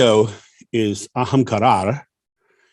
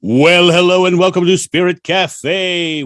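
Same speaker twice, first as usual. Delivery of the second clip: big host welcome; 8 semitones higher, 6 dB louder than usual. Acted. Show opening, big host welcome.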